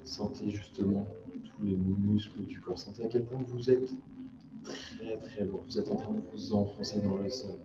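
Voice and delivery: soft, calm relaxation voice; guided meditation. Acted. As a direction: ease them into stillness.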